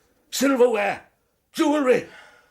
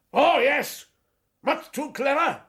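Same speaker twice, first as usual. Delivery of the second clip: ordinary talk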